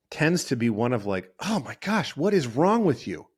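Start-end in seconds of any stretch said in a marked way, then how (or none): 1.4-3.3 higher, incredulous wife scolding husband; exasperated scolding. Retold or imitated. Wife scolding husband after a fart, exasperated. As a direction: scold him like he's hopeless. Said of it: "it's this tone of".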